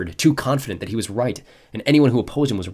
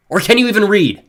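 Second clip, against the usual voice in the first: loud mocking insult; raised voice; 11 semitones higher, 12 dB louder than usual. A narrator's reading. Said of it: "Jen's dad raised his voice".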